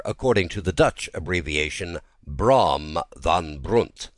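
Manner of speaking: usual speaking voice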